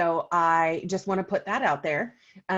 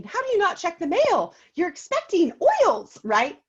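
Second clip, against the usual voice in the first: high, louder disbelief; can't believe she didn't check; incredulous; 9 semitones higher, 6 dB louder than usual. Retold or imitated. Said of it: "And I'm thinking".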